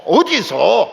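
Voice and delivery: loud, fast Jeolla dialect protest. Retold or imitated. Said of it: "전라도 사람은". (전라도 사투리로 흉내 낸 항의)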